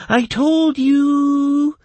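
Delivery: very high, loud, drawn-out elf voice; gleeful sing-out; happy elf. A narrator's reading. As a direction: stretch it out in high-pitched glee. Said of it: "happily smiled the hairless elf".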